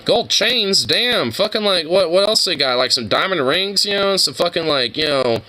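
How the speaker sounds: high, excited disbelief; hyped up about blinged-out squirrels; amazed. Real, not acted. Streamer marvelling at squirrels in gold chains, high and excited. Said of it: "amazed at squirrels in gold chains".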